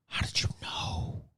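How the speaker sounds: an eerie hushed whisper. Pretend. Streamer replaying his answer to chat as a spooky whisper. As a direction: breathe it out like a secret.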